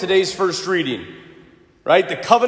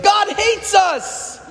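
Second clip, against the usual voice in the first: put-on kid voice; 13 semitones higher, much slower than usual. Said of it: "they're like".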